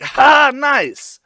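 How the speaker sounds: high, very loud retold exclamation; gleeful mock cheer. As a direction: cheer back over your shoulder. Retold delighted look back.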